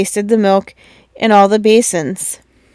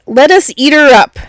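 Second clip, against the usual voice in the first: father bear growl; 4 semitones higher, 3 dB louder than usual. A narrator's reading. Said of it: "Then Father Bear growled".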